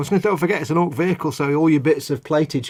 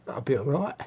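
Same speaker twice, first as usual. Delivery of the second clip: quiet put-on Cockney; a soft, jokey Cockney accent; 8 dB quieter than usual. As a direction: slip softly into mock Cockney. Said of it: "was that your Fox Cockney accent coming out again?".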